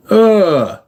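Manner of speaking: ordinary delivery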